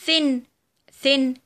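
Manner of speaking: "thin" with breathed th at the start; voiceless TH. Shown as a demonstration. Teacher gives vowel examples, "thin" with voiceless th. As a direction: model "thin" twice with unvoiced th. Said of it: "ونطقت ث".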